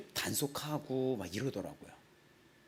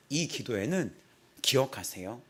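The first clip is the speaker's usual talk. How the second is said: ordinary delivery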